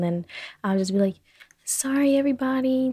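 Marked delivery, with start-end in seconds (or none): 1.6-2.9 high, singsong sorry to everybody; playful, higher-pitched fake apology; mock apology. Acting out apology to listeners.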